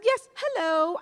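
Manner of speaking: grand mock English greeting; English accent. Self-styled VIP acknowledging the usher, English accent. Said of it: "like I had an English accent".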